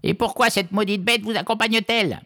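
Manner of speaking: very high, disagreeable witch voice; croaking witch. A narrator's reading. Witch rails at the fisherman about his dragon, high and croaking. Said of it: "croissa la sorcière".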